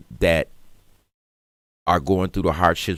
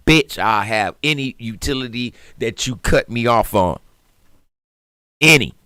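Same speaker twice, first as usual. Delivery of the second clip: louder, higher cocky promise; boastful husband; 5 semitones higher, 5 dB louder than usual. Retold or imitated. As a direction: swagger like you'll fix everything.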